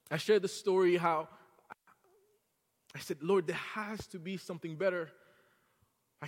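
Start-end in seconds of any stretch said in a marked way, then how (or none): none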